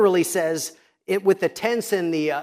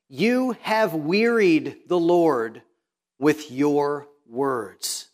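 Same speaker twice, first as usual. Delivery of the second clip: slow solemn reading; 4 semitones lower, much slower than usual. Shown as a demonstration. Reading the Lord's rebuke slowly.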